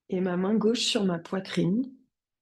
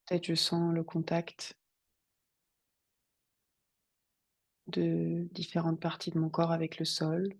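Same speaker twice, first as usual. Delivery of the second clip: guided meditation; 2 semitones lower, 6 dB quieter than usual. Acted. Yoga teacher guiding students to settle on the mat, meditative calm. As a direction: settle them calmly into stillness, unhurried.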